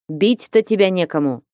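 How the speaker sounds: usual speaking voice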